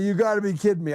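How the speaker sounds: high-pitched, pleading disbelief; mock incredulous protest. Host replaying his argument with reviewers, high incredulous protest. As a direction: plead your case in an exaggerated high voice.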